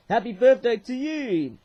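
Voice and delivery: high, loud cheer; loud, celebratory read-out; exuberant birthday greeting. Acted. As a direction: call out the greeting with a big lift.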